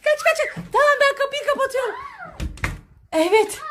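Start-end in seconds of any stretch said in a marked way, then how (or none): 0.0-1.9 excited shouts. Real, not acted. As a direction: hurry everyone into the room. Fleeing into the room. (yüksek sesle telaşlı bağırış)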